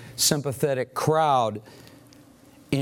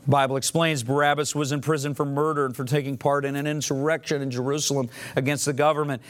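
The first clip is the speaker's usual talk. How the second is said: normal talking voice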